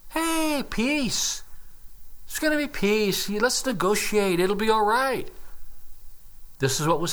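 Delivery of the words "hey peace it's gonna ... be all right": higher, soothing mock reassurance; mock peace promise. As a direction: parrot the empty promises, pitched up. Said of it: "People are promising".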